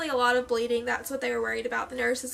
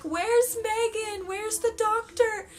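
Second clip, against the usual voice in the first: frantic asking; 9 semitones higher, much slower than usual. Retold or imitated. Panicking mid-labour, asking for help.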